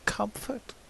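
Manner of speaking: soft rising questioning Comfort?; hushed disbelieving query; doubtful question. Acted. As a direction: ask Comfort? quietly and rising. Questioning the word, then scorning it.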